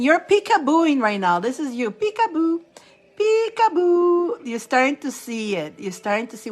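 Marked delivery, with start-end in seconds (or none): 1.9-2.6 high, quick, teasing "Peekaboo"; playful peekaboo. Pretend. 3.2-4.4 high "Peekaboo", stretched slow, rising at the end; drawn-out peekaboo. Pretend. Playfully teasing the viewers, drawn out.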